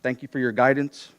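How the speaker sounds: ordinary talk, nothing marked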